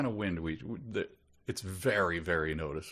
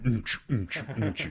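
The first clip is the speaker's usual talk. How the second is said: club beat; 4 semitones higher, slower than usual. Retold or imitated. Imitating club music.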